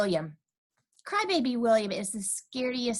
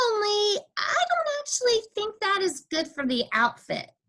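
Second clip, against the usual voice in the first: whiny; 11 semitones higher than usual. A narrator's reading. Caught, pleading with teacher.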